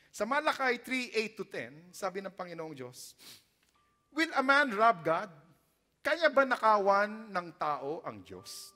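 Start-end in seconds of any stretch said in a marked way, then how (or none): 4.1-8.7 slow, loud, booming scripture; loud slow voice of God; proclaiming God's words. Acted. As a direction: thunder out God's question slowly. Preacher reads God's question.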